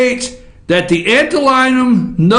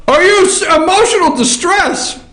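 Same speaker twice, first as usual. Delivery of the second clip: ordinary talk, nothing marked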